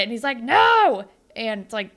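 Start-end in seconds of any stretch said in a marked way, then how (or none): 0.4-1.0 loud, high shouted no; emphatic refusal. Retold or imitated. Connor refusing Dave in front of the team, shouted. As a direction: bark out a flat refusal.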